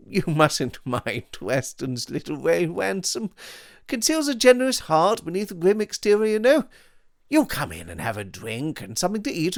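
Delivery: languid upper-class gent. Acted. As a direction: play him smug and breezy. Devine and Ransom voices.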